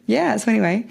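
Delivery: ordinary talk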